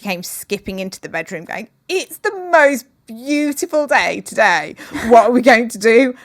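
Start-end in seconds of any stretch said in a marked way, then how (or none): 1.9-6.1 put-on high, loud, bubbly little-girl voice; excited child. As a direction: burst in like a joyful child on a sunny morning. Daughter skipping in next morning.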